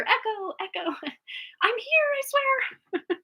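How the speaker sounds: high, slow, laughing reply; delayed echo voice. Pretend. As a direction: pretend to be a lagging viewer. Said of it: "you finally hear".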